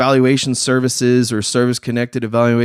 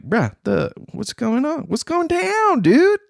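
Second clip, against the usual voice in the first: pitched way up in shocked confusion; startled disbelief; 11 semitones higher, slower than usual. Retold or imitated. Facing a guard's hand on his weapon, startled and high. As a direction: squeak it out, caught off guard. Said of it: "I'm like".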